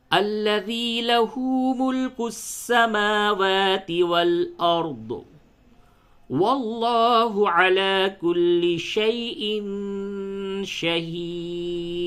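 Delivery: slow, high, drawn-out melodic chant; Quran recitation. Shown as a demonstration. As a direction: chant the full verse slowly with heavy lam in Allah. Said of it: "تو پوری آیت یوں پڑھیں".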